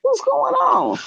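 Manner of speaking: high, slow mock-confused husband; hammed-up husband's alarm; imitating husband. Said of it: "my husband be like".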